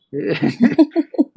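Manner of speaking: loud amused laugh; burst of laughter. Real, not acted. Laugh before mocking critics.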